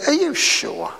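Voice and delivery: high, wheedling query; doubtful questioning. Acted. Believer arguing with conscience, high and doubtful. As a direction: question it high, as if angling for another answer. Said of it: "And we say".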